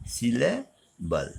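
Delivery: सिले, pause, बल, slowly; word split up. Shown as a demonstration. Teacher introducing a term to children, syllable split slowly. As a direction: say syllable slowly in two separated parts.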